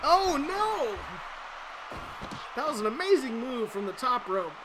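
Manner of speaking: high, loud excited wrestling commentary; live play-by-play. Acted. Commentator calling the match for the viewers, excited play-by-play. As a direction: call every move like a ringside announcer.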